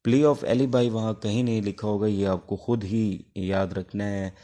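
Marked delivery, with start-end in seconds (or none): none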